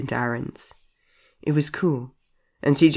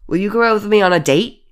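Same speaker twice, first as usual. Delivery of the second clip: insistent question; 8 semitones higher, 6 dB louder than usual. Acted. Repeats the date request clearly.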